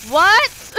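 high, loud disbelieving shout; shocked yell. Real, not acted. Sudden shock at game jumpscare.

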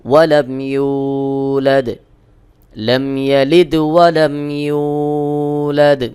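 slow tilawat with ইউ stretched one alif; long u recitation. Shown as a demonstration. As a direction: draw the ইউ out one beat. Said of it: "এক আলিফ টান দিয়ে পড়তে হবে". (ইউ এক আলিফ টেনে তিলাওয়াত)

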